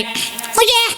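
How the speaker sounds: put-on high, small boy's answer; young schoolboy piping up; child's voice. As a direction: squeak it like a little kid. Boy called over by teacher.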